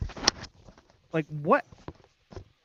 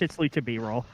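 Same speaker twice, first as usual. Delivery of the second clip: normal talking voice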